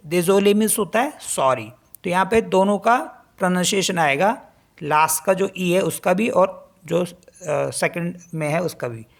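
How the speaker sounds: ordinary delivery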